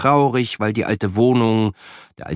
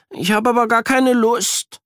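put-on reluctant boy; 13 semitones higher than usual. A narrator's reading. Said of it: "murmelt er".

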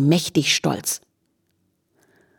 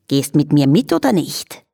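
wheedling Viennese question; cooing flea; 3 semitones lower than usual. A narrator's reading. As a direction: coax with a little lilt. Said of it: "säuselte der Floh".

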